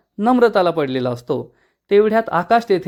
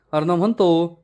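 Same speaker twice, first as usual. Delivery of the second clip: plain reading voice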